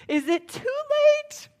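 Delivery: very high mock-desperate calling, tearful at the end; hammed-up fan crying after him; starstruck pleading. Calling after the departed star.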